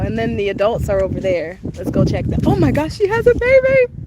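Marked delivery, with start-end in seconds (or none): 2.5-3.9 high, loud burst of delight; thrilled squeal. Real, not acted. Streamer spotting a baby, thrilled.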